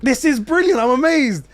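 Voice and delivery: high-pitched, gushing thrill; retold excitement. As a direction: act out being blown away. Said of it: "I'm getting excited".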